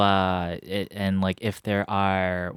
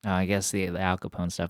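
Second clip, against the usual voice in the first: ordinary delivery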